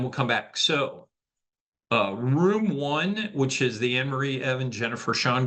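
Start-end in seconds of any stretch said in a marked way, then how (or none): none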